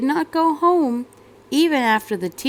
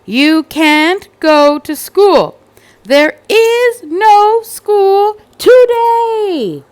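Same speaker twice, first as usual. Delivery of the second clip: much louder, higher, slow drawn-out shout; parents yelling; 10 semitones higher, 10 dB louder, much slower than usual. Acted. Mother and father yelling at Cassandra, slow loud shout. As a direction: bellow it at a stubborn kid. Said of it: "both yelled".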